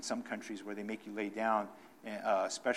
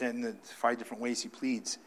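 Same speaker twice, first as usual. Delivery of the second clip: ordinary talk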